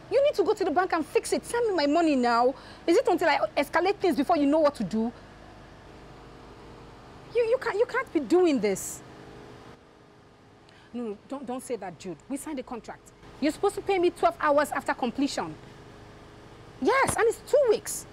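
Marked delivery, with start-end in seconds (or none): 0.1-9.0 angry shouting. Acted. Wife yelling at Jude to fix the payment, furious. Phone quarrel over money. 13.4-18.1 outraged. Acted. Wife reminding Jude of the contract, outraged shout. Phone quarrel over money.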